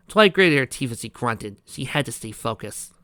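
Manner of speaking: ordinary delivery, nothing marked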